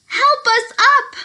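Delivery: high, loud cry for help; little letters crying for help; cried out. A narrator's reading. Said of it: "cried A B C".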